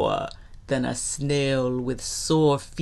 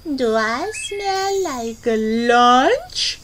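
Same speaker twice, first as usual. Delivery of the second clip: high, slow, syrupy sweetness; sugarcane voice; 9 semitones higher, 5 dB louder, slower than usual. Acted. Skunk taunts gator with her perfume, sugary sweet. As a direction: coo it slowly with teasing sweetness. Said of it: "says in his sugarcane voice".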